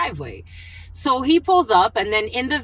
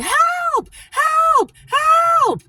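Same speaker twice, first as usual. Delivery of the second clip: very high mock scream for help; imitated yelling; 5 dB louder, much slower than usual. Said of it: "She started yelling".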